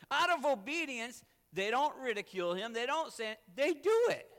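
loud, high-pitched sermon delivery; fervent preaching. Real, not acted. Preacher to congregation on obedience, fervent preaching. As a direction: preach it with full-voiced fervour.

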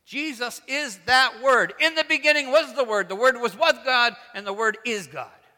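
loud, high, ringing declaration of scripture; full-force preaching. Real, not acted. Preacher declaring who the Word is, full-force preaching. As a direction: proclaim it forcefully to the whole church.

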